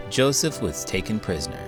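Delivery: usual speaking voice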